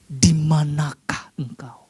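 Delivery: slow, hushed whisper of God calling; whispered question. Shown as a demonstration. Preacher trying a whispered God asking Adam, hushed. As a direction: breathe the question out slowly. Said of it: "Nggak tahu seperti apa intonasinya".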